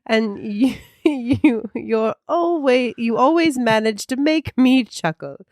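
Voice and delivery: high, hammed-up posh British voice; posh British accent. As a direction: read slowly in exaggerated posh British, giggling midway. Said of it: "now I'm going very posh with my accent".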